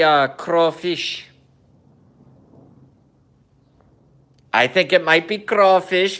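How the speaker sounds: a light mock Kazakh Borat accent in broken English. Vlogger joking to viewers in a light mock Borat accent. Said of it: "I'm talking too much like myself".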